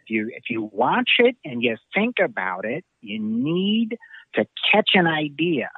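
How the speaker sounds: slow and drifting, like an odd deadpan director; David Lynch impression. Telling a host how Lynch talks, slow dreamy high imitation. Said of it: "he talks very much like this".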